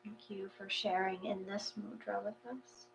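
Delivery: hushed, soft thanks; meditation closing. Acted. Leader thanking the group after the mudra, softly hushed. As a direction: close the session gently and quietly.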